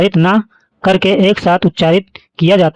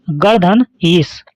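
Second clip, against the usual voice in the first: reads the two words very slowly; slow spelled-out example; much slower than usual. Shown as a demonstration. Teacher showing the example to students, very slow. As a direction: lay each word out slowly for the class. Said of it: "दूसरा उदाहरण देखिए".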